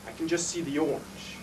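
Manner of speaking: slow, soft, fading nearly to whisper; low, barely voiced; awed hush. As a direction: let it sink to a hushed memory. Awed memory of the fire.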